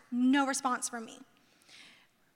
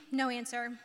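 ordinary delivery

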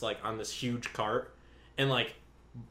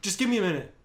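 higher-pitched, startled mock plea; imitating a scared coworker; 7 semitones higher, faster than usual. Manager imitating the coworker caught in the freezer, scared. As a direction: act caught out and frightened. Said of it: "afraid, scared, whatever".

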